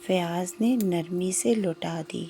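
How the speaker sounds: ordinary delivery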